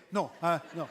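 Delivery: normal talking voice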